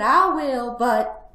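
high, loud piglet concession; reluctant little piglet; sighing resignation. Acted. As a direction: give in, leaving a catch hanging. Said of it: "sighed the piglet".

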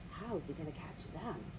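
quiet, wondering question; hushed aside. Real, not acted. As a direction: lean in and ask softly.

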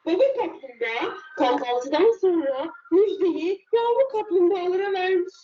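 ordinary delivery, nothing marked

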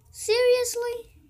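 high, loud, drawn-out seriously; real disbelief, stretched slowly; incredulous. Real, not acted. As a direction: sound truly unbelieving at the loot. Disappointed with loot.

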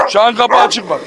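normal talking voice

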